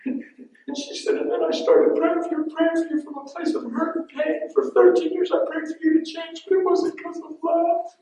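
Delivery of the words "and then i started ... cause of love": very high, loud tearful squeal; imitated bawling wife. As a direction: wail out her confession in falsetto, hammed-up. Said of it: "She's running to me bawling".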